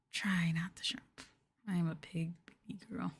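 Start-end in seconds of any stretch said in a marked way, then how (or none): none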